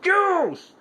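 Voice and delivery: high, loud shout. Shown as a demonstration. Showing Nick how to speak up, a loud shout. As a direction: yell the word out like a man should. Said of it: "I said speak up like a man".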